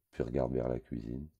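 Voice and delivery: ordinary delivery, nothing marked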